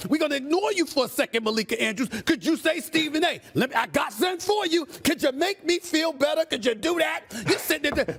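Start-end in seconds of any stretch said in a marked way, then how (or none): 2.8-7.2 high, punchy sales pitch; enthusiastic. Shown as a demonstration. Commentator shows a panelist how to pitch him a trade, enthusiastic. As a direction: sell it with your chest out. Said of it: "Could you do it with some enthusiasm?".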